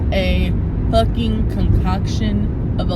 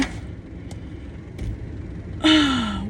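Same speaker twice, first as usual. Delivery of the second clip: long, soft breathy exhale; exasperated sigh; 2 semitones higher, 7 dB quieter than usual. Real, not acted. Reeling from running into an ex, exasperated sigh. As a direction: let out a long weary breath.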